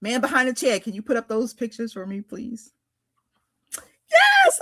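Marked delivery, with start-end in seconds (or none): 3.8-4.6 high-pitched thrilled yes; excited yelp. Real, not acted. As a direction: yelp with delight. Excited about pose photos.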